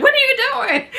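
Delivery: high, incredulous yell at another driver; laughing exasperated shout at traffic. Retold or imitated. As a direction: shout it in disbelief, half laughing. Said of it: "I'm like".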